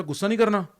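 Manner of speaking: loud high scolding; loud, high scolding elders; scolding parent. Retold or imitated. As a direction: scold the child sharply. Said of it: "सबने बोला".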